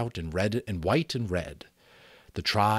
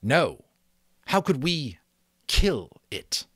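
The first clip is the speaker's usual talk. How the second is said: louder, earnest chief's voice; vague but earnest chief; 6 semitones higher, 5 dB louder, slower than usual. Acted. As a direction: insist earnestly without knowing why. Chief denies killing beast.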